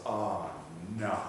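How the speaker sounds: low, soft, slow "oh no"; sinking realization, dropped low and hushed; quiet dread. Acted. Satan turns to the door, quietly dismayed. Satan sees his defeat arriving.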